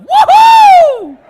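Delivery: shouted, very high whoop; woohoo cheer. Retold or imitated. A passionate cheering at good news, whooping loudly. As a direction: let out a wild whoop of joy. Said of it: "their version of".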